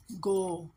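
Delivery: गो held long as "goh"; syllable sound demo. Shown as a demonstration. Teacher joins consonant and vowel, drawn-out गो. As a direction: stretch गो as one syllable. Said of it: "किसके साथ मिलेगा तो".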